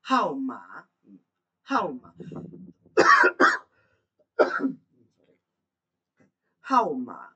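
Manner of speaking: Hao ma said loud, hao falling sharply in the fourth tone; slow, loud Mandarin drill of Hao ma. Shown as a demonstration. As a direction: repeat Hao ma with a steep falling hao. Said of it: "hao ma itu hao-nya nada empat ya".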